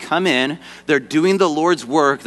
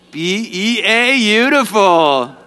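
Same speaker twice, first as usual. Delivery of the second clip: high, drawn-out spelled-out cheer; sing-song swooning audience cue, stretched slowly; cheering catchphrase; 6 semitones higher, slower than usual. Pretend. Audience cue for Abigail's name.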